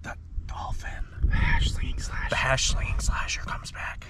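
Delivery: hushed mock-scary whisper; spooky whisper. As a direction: whisper it like a campfire horror tale. Spooky mock dread about dolphin.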